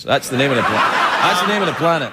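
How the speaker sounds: normal talking voice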